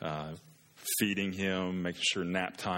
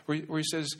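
usual speaking voice

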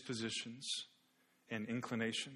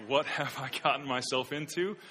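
louder, mildly alarmed self-questioning; startled inner thought; 3 semitones higher, 7 dB louder than usual. Acted. Man's startled thought about her.

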